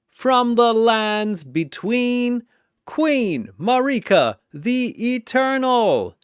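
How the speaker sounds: high, loud fight-night intro; boxing announcer calling fighters; arena announcer. Acted. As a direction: hype each fighter like a ring announcer.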